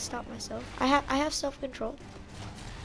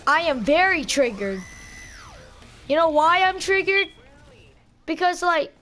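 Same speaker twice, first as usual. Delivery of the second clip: triggered; 6 semitones higher, 7 dB louder than usual. Real, not acted. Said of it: "I'm so triggered".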